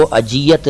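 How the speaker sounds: अजियत with a j sound; wrong pronunciation. Shown as a demonstration. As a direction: say अजियत with ज as in जल, not z. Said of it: "वो अजियत नहीं है". (अजियत में ज़ की जगह ज का ग़लत उच्चारण)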